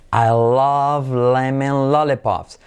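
slow, even linking drill; drawn-out, level model for learners; slow model sentence. Shown as a demonstration. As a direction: stretch each L in "love lemon lollipops". Slow repetition drill before intonation.

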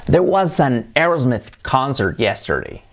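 slow model English, "Aerosmith" as ERS-meth; careful English AIRS-meth in a sentence; English pronunciation. Shown as a demonstration. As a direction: say it slowly as an English example. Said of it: "hay que decir Aerosmith".

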